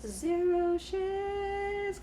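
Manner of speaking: very high, very slow zero shits; drawn-out high. Real, not acted. Reader to viewers about the card, slow high stretch.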